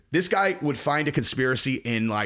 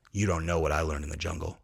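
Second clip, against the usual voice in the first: much lower, softer, menacing little man; ominous mock boast; 11 semitones lower, 6 dB quieter than usual. Comic voicing a tiny fighter boasting of jungle skills, low ominous. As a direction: make the jungle claim sound sinister.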